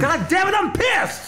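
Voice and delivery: very high, exasperated retold outburst; upset. Drunk man who lost his wallet, upset. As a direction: let it burst out high and pissed off.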